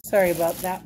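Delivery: ordinary delivery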